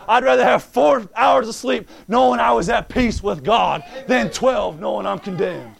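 loud, rising preacher's cadence, punching key words; full-force preaching. Acted. Preacher exhorting the church about the bride, full force. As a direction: preach it to the congregation.

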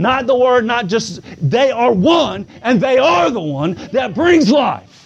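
full-force revival sermon climax; fiery preaching. Acted. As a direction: preach it out hard to the congregation.

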